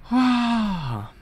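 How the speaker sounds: long, high acted "ah"; acted wail of pain; high pained cry. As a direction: cry out as the character, falling away. Reading manga, character in pain.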